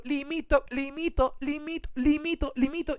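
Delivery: high repeated limito like a skipping track; sing-song stuttering limito; mock looping singer. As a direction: imitate a stuck playback loop.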